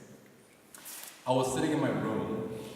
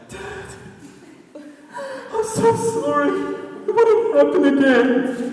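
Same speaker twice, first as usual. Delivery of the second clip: much higher, hammed-up wailing apology; mock sobbing; 12 dB louder, slower than usual. Teenage son to dad in the car, mock tearful wailing. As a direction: blubber it like a melodrama teen, half laughing. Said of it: "his son gets all emotions".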